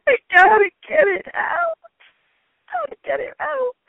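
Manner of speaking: high, strained panicky repetition; frantic. Retold or imitated. Patient in the shower, panicking to the mother. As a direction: squeak it out in rising panic.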